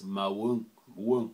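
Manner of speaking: "mawun" with a nasal "un" ending; Patois "mawun"; Patois nasal sound. Shown as a demonstration. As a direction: stress the nasal "wun". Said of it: "We also have a special sound".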